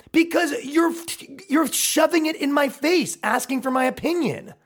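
high, strained indignation; exasperated retort. Acted. Man explaining his problem to provokers, exasperated. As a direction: push it out high, at wit's end.

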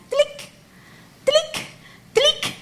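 tiny high drips, spaced apart; imitated teardrops. Preacher telling how tears drop in the dark, imitated drip sound. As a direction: imitate falling drops with high-pitched clicks. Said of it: "suenan unas gotas de lágrimas".

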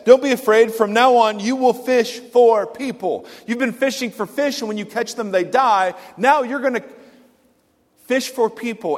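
full-force sermon delivery; preaching. Acted. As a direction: proclaim it with force.

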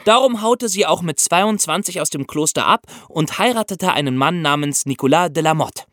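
ordinary delivery

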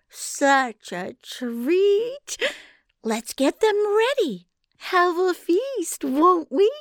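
very slow, high, gleeful sugary voice; syrupy giantess. A narrator's reading. Giantess gloating to Conrad about the feast, syrupy high. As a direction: savour the feast to come. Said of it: "her syrupy voice".

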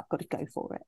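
hushed self-talk whisper. Retold or imitated. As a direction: breathe it out under your breath. Retelling her own inner resolve.

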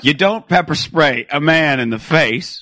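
slow and deliberate, saying comma; slow spelled-out dictation. Shown as a demonstration. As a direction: dictate slowly with laughter. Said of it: "Let's take this one at a time".

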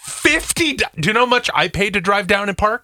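high incredulous "Fifty"; outraged disbelief. Real, not acted. Host shocked at the fifty dollar fare, high and incredulous. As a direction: pitch it up in disbelief.

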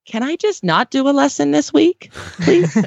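pleading horse, voiced lightly. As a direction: beg like a tired lesson horse. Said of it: "his New Year's resolution might be".

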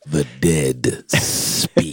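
very low, very slow and ominous; ominous deep voice. Acted. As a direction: intone it like a doom-laden trailer. Echoes the crawl ominously.

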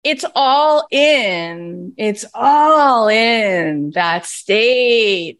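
very slow, stretched emphasis. Real, not acted. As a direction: draw every word out. Drawn out emphatic point.

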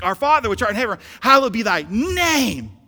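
high, loud build landing hard on "name"; emphasis on name. Shown as a demonstration. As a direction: shout the prayer, "name" stretched long and loud. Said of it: "This is how it would read in the Greek".

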